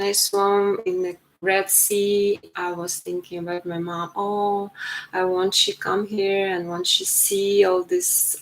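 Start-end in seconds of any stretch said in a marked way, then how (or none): none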